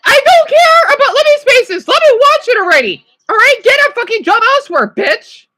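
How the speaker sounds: fast furious rant; irritated yelling. Real, not acted. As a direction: snap furiously at an ad that won't end. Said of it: "this ad's getting me irritated".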